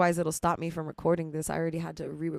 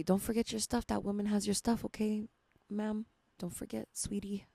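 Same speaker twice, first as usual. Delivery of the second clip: higher, softer voice of passers-by; hushed warning; 3 semitones higher, 7 dB quieter than usual. Retold or imitated. Strangers leaving a club tell her about her stuff, hushed warning. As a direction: imitate a hushed reminder, fading towards a whisper.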